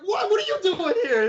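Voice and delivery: very high, squealed fan reaction; starstruck surprise. Retold or imitated. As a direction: imitate a fan shocked by a celebrity, voice shooting up. Retold fan surprised by a celebrity.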